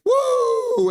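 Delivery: very high, loud "woo"; piercing playful whoop; acted whoop. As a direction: squeal out a long cheer. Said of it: "Sensei D N I is like".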